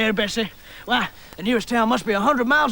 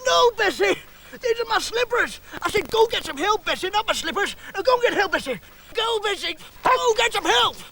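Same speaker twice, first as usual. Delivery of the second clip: high, urgent cries; exasperated pleading; 9 semitones higher than usual. Acted. Injured woodsman corrects Bessie, exasperated high pleading. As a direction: order it desperately, pitched way up. Woodsman scolds dog, begs help.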